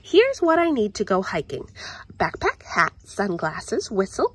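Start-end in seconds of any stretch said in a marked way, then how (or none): none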